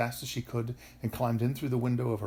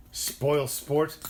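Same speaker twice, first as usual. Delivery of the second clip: louder, higher taunt; mild yell; 4 semitones higher, 6 dB louder than usual. A narrator's reading. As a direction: call it out crossly, not at full volume. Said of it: "yelled the fly-by-night".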